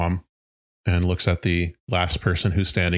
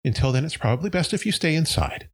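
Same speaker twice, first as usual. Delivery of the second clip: higher, reassuring mayor voice addressing townsfolk; mayor calming crowd; 9 semitones higher than usual. Acted. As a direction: soothe the crowd like a chipper small-town mayor.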